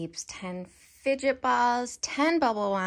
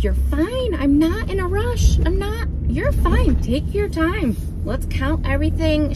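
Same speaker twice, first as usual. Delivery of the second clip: high, louder, eager reassuring; bright reassurance; 7 semitones higher, 5 dB louder than usual. Retold or imitated. Reassuring the overwhelmed cashier, high and bright. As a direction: brighten up to put her at ease.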